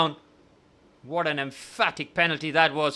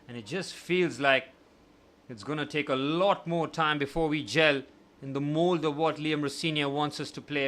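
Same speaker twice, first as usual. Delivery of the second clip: ordinary talk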